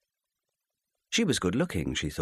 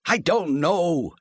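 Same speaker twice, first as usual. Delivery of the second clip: slow, louder and higher cry; cried out; 10 semitones higher, 4 dB louder, much slower than usual. Acted. Husband crying out that he doesn't know, loud. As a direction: drag it out in exasperation. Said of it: "he cried".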